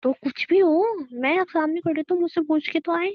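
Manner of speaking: heated, high-pitched objection. Acted. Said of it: "पहले तो तुम थोड़ा धीरे बोलो".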